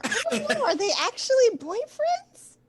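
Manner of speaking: high, loud gossipy bystander; background onlooker. Acted. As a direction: pipe up loudly from the back of the room. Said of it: "In the background, you hear".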